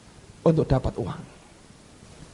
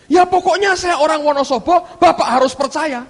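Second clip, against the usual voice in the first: high, loud stubborn insisting; insistent claim; 12 semitones higher, 6 dB louder than usual. Retold or imitated. Con man insists he is from Wonosobo, stubborn and loud. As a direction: insist like a cornered liar. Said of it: "teman saya berkata begini".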